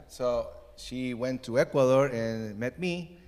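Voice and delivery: usual speaking voice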